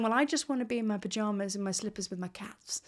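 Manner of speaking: whispered, wanting to hide in slippers. Retold or imitated. Voicing the inner self that wants pajamas, whispered. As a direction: hush it like a tired confession. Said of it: "you start going".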